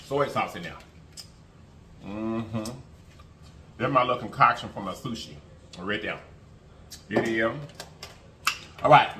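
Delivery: ordinary talk, nothing marked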